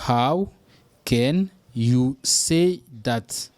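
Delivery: How set apart, then drawn out; slow teaching model; very slow, broken up. Shown as a demonstration. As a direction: stretch every word for learners.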